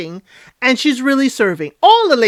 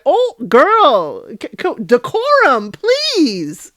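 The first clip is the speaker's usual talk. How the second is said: mock scolding; 5 semitones higher, much slower than usual. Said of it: "And I was like".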